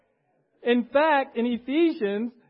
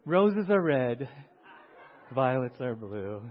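laughing poem; 3 semitones lower, 8 dB louder, slower than usual. Pretend. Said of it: "joking poem recital".

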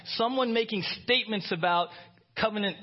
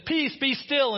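rebuking the waves; 7 semitones higher, 4 dB louder, slower than usual. Acted. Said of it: "He says".

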